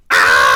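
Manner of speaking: long, full-force scream; big panicked cartoon scream; shouted scream. A narrator's reading. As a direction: let out a loud sustained "ah". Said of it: "Gerald shouts".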